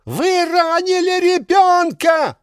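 high, slow shriek of a woman; hysterical woman. Acted. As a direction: shriek it in outrage.

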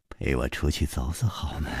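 low, soft murmur; very quiet, low-pitched muttered plea. A narrator's reading. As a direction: mumble the request under your breath. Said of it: "喃喃地说".

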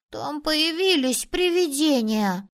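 put-on high, slow, spooked-kid voice; interrupting boy. A narrator's reading. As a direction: cut in with a drawn-out boyish claim. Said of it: "перебил его другой мальчик". (мальчик перебивает, протяжно и высоко)

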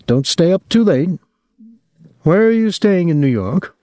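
anxious mother pleading; worried old mother. Acted. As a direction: nag him about the reckless driver. Mother fussing over son.

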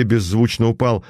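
usual speaking voice

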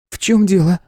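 Nuy's voice. A narrator's reading. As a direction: voice the young woman, concerned. Nuy voiced asking.

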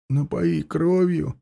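slow, soft, vacant echo; mindless repeating. Acted. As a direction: repeat it blankly, as if entranced. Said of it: "бездумно повторил за ней Власка". (бездумный, тихий повтор)

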